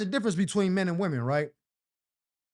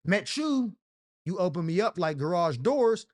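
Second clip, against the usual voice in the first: usual speaking voice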